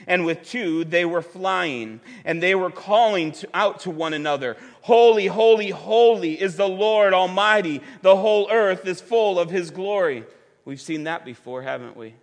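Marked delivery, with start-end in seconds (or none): 4.8-10.2 louder, ringing call of the seraphim; proclaiming scripture. Acted. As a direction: cry it out like angels calling to each other.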